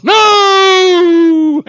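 long, high, loud wail; drawn-out dismayed howl; horrified cry. Retold or imitated. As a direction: wail no in mock horror. Acts out preacher's horrified reaction.

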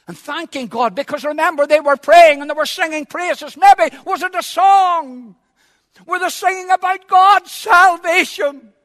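loud, high-pitched preacher's cry; impassioned shouted sermon; full-force preaching. Acted. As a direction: thunder it out from the pulpit, stretching key words.

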